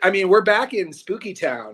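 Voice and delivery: ordinary talk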